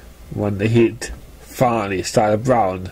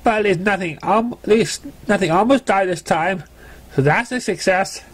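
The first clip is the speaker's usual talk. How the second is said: higher, sheepish filly voice; Scooter making excuses; 7 semitones higher than usual. Acted. Scooter excusing her retreat to Sparkler, higher sheepish voice. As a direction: wriggle out of it with a flattering grin.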